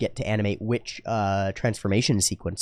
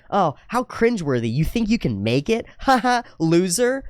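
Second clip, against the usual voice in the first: higher, sneering imitation of a bully; dismissive mocking; 7 semitones higher than usual. Someone dismissing people with passion, mocking them. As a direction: jeer with a fake laugh. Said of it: "to dismiss people with passion".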